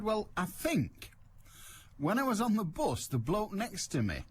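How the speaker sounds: quoting the lady. Retold or imitated. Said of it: "She said".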